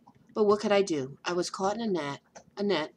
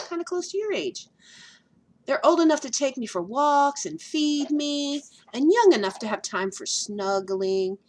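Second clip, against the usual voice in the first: lightly voiced dog, louder and higher; earnest dog voice; 5 semitones higher, 5 dB louder than usual. Dog telling flea what he needs. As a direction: plead for a child to care for him. Fletcher explains his wish.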